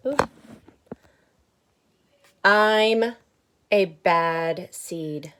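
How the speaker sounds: loud, slow, proud grumbling seed; bad seed's voice. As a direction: announce it like a confession. Book seed speaks, slow and loud.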